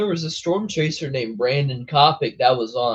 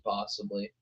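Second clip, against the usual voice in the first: ordinary delivery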